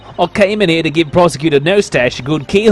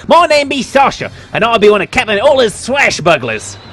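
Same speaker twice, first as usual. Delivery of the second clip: pirate voice; 6 semitones higher than usual. Acted. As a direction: ham it up like a stage pirate. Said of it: "It was in my pirate show persona just then".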